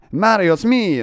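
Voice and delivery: high, loud hammed-up Italian accent; loud mock Italian shout. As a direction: greet Mario like a cartoon Italian waiter. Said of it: "suddenly I'm Italian".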